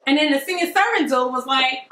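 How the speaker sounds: ordinary talk, nothing marked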